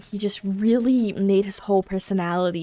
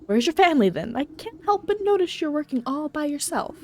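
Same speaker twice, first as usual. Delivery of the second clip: probing visitor; 7 semitones higher than usual. Acted.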